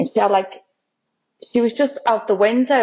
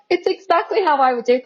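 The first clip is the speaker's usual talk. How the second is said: lightly mimicked mentor, pitched higher; imitated praise; 7 semitones higher than usual. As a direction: quote his compliment in a raised voice. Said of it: "when David's like".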